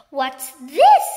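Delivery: startled gasp. A narrator's reading. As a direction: gasp it out in shock. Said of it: "gasped Mister Twit".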